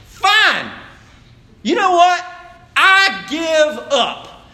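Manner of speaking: loud, high, fed-up outburst, dragging the last words slowly; exasperated giving up. Retold or imitated. Wife finally hands husband the budget, loud and fed up. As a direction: throw up your hands and hand it over, loudly.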